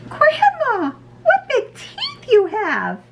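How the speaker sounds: put-on very high, slow, alarmed little girl. A narrator's reading. Red notices Grandma's sharp teeth, very high girlish squeal. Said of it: "And she said".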